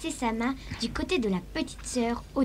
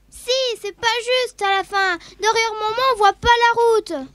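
loud, high bratty protest; whiny shouting child; 6 semitones higher, 10 dB louder than usual. Acted. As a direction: yell like a spoiled little sister. Sister squabbling over window seat.

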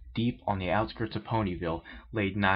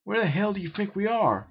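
higher, argumentative retort; an irritated comeback; argued; 11 semitones higher, 3 dB louder than usual. A narrator's reading. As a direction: argue back sharply. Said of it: "argued Nathan".

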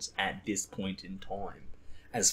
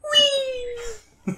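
very high, long "wee" squeal like a thrill ride; imitated joyful squeal; 8 dB louder than usual. Friend retells body surfer's GoPro cry, very high squeal. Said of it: "you just hear it go".

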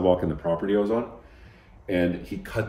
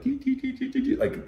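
high light imitated rattle; mimicked clicking; 5 dB quieter than usual. Describing the gate giving way to a friend, high mimicked ticks. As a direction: tick it out high like a door sliding up.

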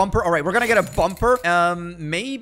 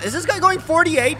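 surprised; 10 semitones higher, 4 dB louder than usual. Real, not acted. Spotting a wildly speeding driver.

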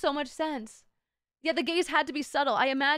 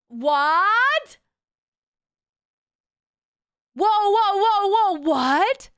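loud, high, incredulous yelps; real shock; 8 semitones higher, 9 dB louder, much slower than usual. Real, not acted. Streamer stunned by what she looked up, loud disbelief. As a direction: blurt it out, stunned, pitch shooting up.

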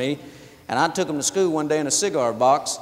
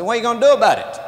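bully's taunt; 7 semitones higher, 8 dB louder, faster than usual. Retold or imitated. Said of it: "Herbie bows up and said".

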